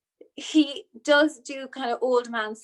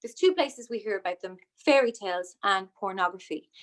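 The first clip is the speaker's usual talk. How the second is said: ordinary talk, nothing marked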